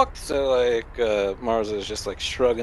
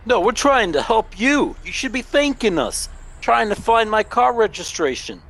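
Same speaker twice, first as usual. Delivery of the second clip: higher, protesting voice; indignant character; 9 semitones higher than usual. Pretend. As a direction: argue back, offended. Character arguing with cop.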